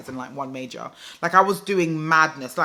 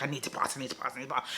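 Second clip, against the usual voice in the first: low, soft run-on repetition; rushed self-pressure; 3 semitones lower, 10 dB quieter, much faster than usual. Retold or imitated. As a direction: mutter it over and over, hurried. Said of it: "I was rushing myself to pass".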